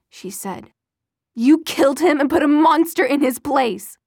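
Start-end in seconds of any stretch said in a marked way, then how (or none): 1.3-4.0 loud, higher, bitter outburst; mother accusing the thing wearing her son's face; angry accusation. Acted. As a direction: hurl the accusation through the door. Mother accusing vampire son.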